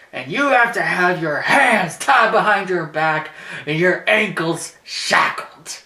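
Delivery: slow, loud, hammed-up promo; Paul Heyman. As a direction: build to a stretched-out announcement of each condition. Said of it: "And he's like".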